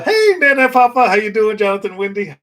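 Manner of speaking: high, loud greeting to viewers; excited greeting. Real, not acted. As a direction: call out hello to viewers by name. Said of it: "greeting viewers".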